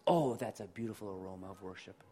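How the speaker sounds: a delighted heavenly father, low and quick; voicing God. Pastor voicing God receiving a surrendered prayer, low and pleased. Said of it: "And he goes".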